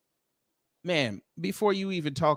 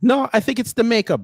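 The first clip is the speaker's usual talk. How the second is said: louder and higher, insisting it's the makeup; louder higher "No, I think it's the makeup"; louder retort; 7 semitones higher, 5 dB louder than usual. Retold or imitated. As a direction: shoot back that it's the makeup. Mock reply, then repeat.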